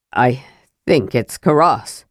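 low, halting dwarf voice; Flint, struggling to speak. A narrator's reading. Dwarf names the dead hero, lightly halting, low. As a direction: hesitate after the first word, pitched low. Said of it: "Flint had trouble speaking for a moment".

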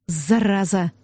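low, mildly growled curse; understated angry growl. A narrator's reading. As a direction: growl it with restrained anger. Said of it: "рыкнул Хенсли".

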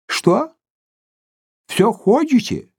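put-on mumbling high old man; old prospector. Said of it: "зашамкал он". (шамкающий старичок)

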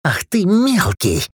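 high, slow furious cry; enraged outburst. Acted. Dudley turns on Rick in fury, high and slow. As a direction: snarl it slowly.

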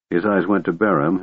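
ordinary delivery

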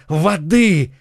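urgent high cry; frantic call for help; shouting for water. A narrator's reading. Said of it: "кричал Д'Артаньян".

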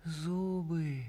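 lightly voiced mumbling old man. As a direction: mumble it with a touch of pity. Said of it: "сострадательно прошамкала Иона".

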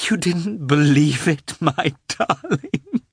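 high, breaking, tender question; overcome mother. A narrator's reading. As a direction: draw out the endearment, near tears. Moved mother answers her son.

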